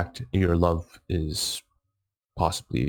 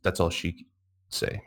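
normal talking voice

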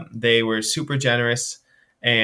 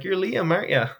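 put-on old lady voice; 7 semitones higher than usual. Elderly woman greets him.

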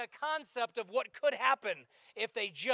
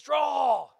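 showdown command; 5 dB louder than usual. Acted.

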